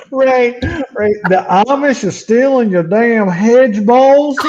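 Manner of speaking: laughing through the words. Real, not acted. Correcting Ray through laughter, laughing. Repeating joke amid laughter.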